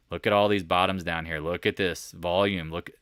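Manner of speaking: usual speaking voice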